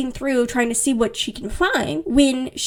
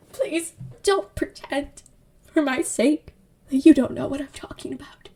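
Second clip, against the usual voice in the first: hammed-up dramatic; 2 semitones higher than usual. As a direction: beg haltingly, voice half whispered. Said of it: "he's all dramatic".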